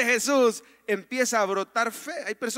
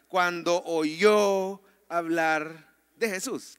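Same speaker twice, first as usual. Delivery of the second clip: "cuando oyó hablar de Jesús" slow, with "oyó" drawn out; underlined phrase; 3 semitones lower, much slower than usual. Shown as a demonstration. Pastor underlining scripture, "cuando oyó hablar de Jesús" slow and stressed. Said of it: "quisiera que subraye esa frase".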